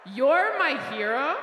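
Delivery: incredulous echo. Retold or imitated. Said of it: "I don't know if you heard that".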